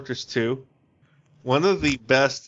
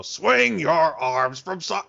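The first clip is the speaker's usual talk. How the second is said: slow, higher, gruff mock singing; really gruff voice; 4 semitones higher, slower than usual. Shown as a demonstration. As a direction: sing it badly in a gruff voice. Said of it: "in the really gruff voice".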